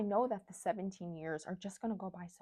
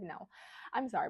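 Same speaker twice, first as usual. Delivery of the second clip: normal talking voice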